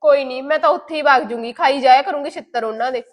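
loud, bitter sarcasm; hurt daughter loudly giving up; sarcastic angry outburst. Acted. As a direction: storm off shouting.

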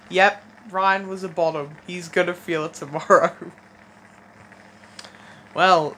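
ordinary delivery

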